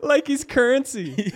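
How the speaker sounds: high, loud, through laughter; real helpless amusement; laughing disbelief. Real, not acted. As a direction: crack up while saying it. Listener cracks up at the joke.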